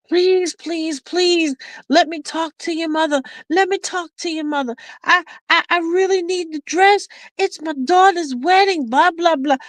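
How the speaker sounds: high-pitched, imitated begging; pleading lady. Lady begging to talk to the mother about the wedding dress, pleading. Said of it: "and it's the lady".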